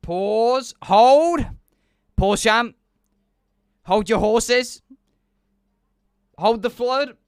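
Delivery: big playful holler to stop; calling a halt. Pretend. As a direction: shout short mock orders, each punched out. Calling out mock commands to halt.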